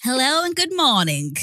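lightly put-on Liverpool greeting; Liverpool accent. Podcast host greeting in Liverpool accent. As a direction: greet in a light Scouse lilt. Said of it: "We love the accent".

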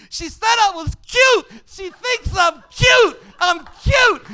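put-on high, shrieking giddy kid; hammed-up lovestruck elation; flushed with excitement. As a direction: squeal it at the top of your voice. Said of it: "flush with excitement after that kiss".

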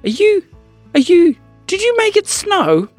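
very high astonished stammer; amazed boy. Acted. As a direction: gasp it out in wonder. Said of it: "said Samuel".

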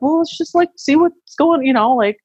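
high, singsong wait-and-see husband; unbothered husband. Retold or imitated. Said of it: "husbands as they are, was, he was very like".

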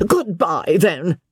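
high, exasperated parting shot; enraged farewell. A narrator's reading. Joss leaving with patience exhausted, mild rage. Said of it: "shaking his fist in a rage".

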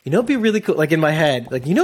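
usual speaking voice

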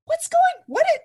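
stunned high-pitched what; shocked confusion. Retold or imitated. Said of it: "I was like".

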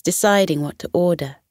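usual speaking voice

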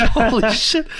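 high, laughing holy shit; laughing disbelief. Retold or imitated. Salesman laughing at the furious homeowner, high disbelief. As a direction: crack up in shock.

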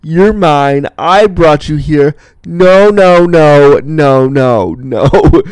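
loud mock diva outrage, key words drawn out; outraged diva. Camper recalling a fit over a counselor dating someone else, outraged diva. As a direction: play up a possessive diva's indignation. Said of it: "biggest diva. I was pissed".

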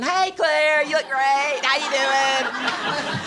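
high, bright forced cheerfulness with a laugh; faked cheer. Mom greeting her kids with forced, high cheer. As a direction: plaster on a fake smile and chirp it up high. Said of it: "so I started faking smiling".

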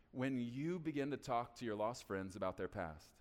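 usual speaking voice

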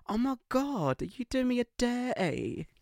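mock outraged Essex woman; shrill Essex woman. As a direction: squeal it like an outraged friend. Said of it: "she was like".

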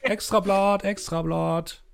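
hoch und laut ausrufender Zeitungsjunge; Zeitungsjunge ruft. Acted. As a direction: schrei die Schlagzeilen auf der Straße aus. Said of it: "Zeitungsjungen rufen". (lauter, hoher Zeitungsjungen-Ausruf)

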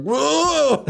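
high, drawn-out comic wail; mock panicked yell. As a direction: imitate a pilot losing control. Pilot panicking in crashing plane.

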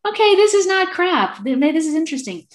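high, loud burst of realisation; newly interested. Retold or imitated. Sceptical patient won over by pulse diagnosis, excitedly interested. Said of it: "I started getting interested".

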